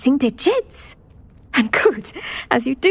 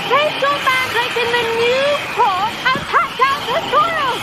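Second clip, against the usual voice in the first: shouting instructions; 11 semitones higher than usual. Acted. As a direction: shout above the din to the class. Urging class over mandrake screams.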